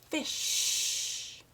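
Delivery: "fish" with the sh held long and hissed; stretched end sound. Shown as a demonstration. Teacher stresses the ending sh of "fish" for a child. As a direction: lean on the sh of "fish". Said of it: "Do you hear the sound at the end".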